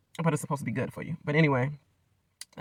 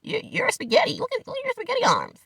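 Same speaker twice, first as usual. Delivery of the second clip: much higher, taunting put-on voice; mock bully taunt. Playing the bullies mocking skinny Gino, high taunting voice. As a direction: sneer like a schoolyard bully. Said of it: "It's like".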